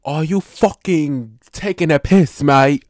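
loud, slow, mock-British outburst; bad British accent. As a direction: exaggerate a cheeky British "mate". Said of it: "that's a bad British accent".

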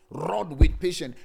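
slow, loud, with each word hit; emphatic loud repeat. Acted. Pastor pressing the phrase on his congregation, loud. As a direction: punch it out to the church.

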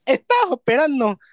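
high, hammed-up costeño impression; imitated Veracruz accent.

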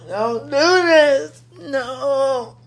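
begging through real tears; crying plea. Real, not acted. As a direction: voice breaking on the words. Overwhelmed, near tears on camera.

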